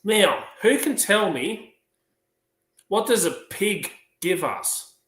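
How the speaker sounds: high, slow schoolteacher voice; high-pitched classroom questioning; teacher addressing class. Acted. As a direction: quiz the class, loud opener then drawn out. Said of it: "She goes".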